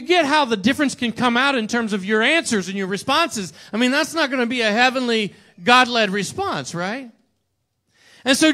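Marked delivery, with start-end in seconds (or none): none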